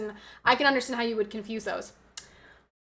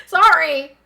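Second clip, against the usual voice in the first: sudden loud, higher SORRY; excited loud apology; 4 semitones higher, 10 dB louder than usual. Real, not acted. Streamer apologizes for hurting viewer's ears, loud excited SORRY. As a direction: blurt out SORRY, loud and raised. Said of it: "got a little bit excited".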